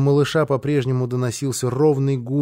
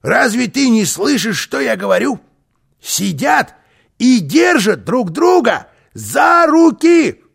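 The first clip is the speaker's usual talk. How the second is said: high, exasperated cry, building louder; indignant shouting; 6 semitones higher, 5 dB louder than usual. A narrator's reading. Карлсон demands boy react, shouting in outrage. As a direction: shout in disbelief, stretching the key words. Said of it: "крикнул".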